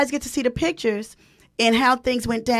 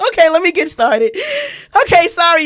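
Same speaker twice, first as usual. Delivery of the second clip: laughing; 6 semitones higher, 5 dB louder than usual. Real, not acted. Host recovering from excitement.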